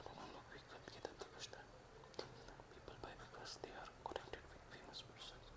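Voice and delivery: far off mic. Real, not acted. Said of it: "recording off-mic, barely audible".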